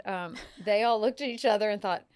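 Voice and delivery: ordinary delivery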